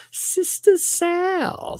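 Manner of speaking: very high, slow name call; playful shout-out. Real, not acted. Host hailing a gifting viewer, playful shout-out.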